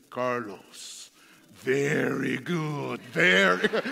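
put-on very slow, deliberate Brazilian-accented praise; thick Brazilian accent. Piano teacher finally praising his student, slow Brazilian accent. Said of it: "thick Brazilian accent".

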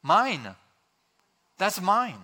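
mock toddler claiming a toy; punchy, falling cries of ownership; possessive two-year-old. Preacher imitating a two-year-old, possessive mock outburst. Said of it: "word that a two-year-old speaks".

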